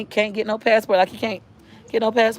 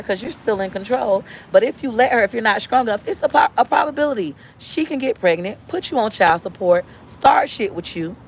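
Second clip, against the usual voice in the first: normal talking voice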